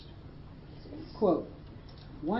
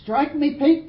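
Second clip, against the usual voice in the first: higher, louder Aussie exclamation; retold yell; 6 semitones higher, 8 dB louder, slower than usual. Rescuer dismayed at his unconscious mate, yelling. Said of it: "He yelled".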